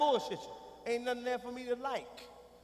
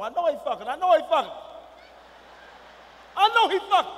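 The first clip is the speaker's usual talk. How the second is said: louder, higher with each repeat; angry insistence; 5 semitones higher, 9 dB louder, faster than usual. Acted. Father sure the man sleeps with his daughter, angry insistence. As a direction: build to an indignant shout.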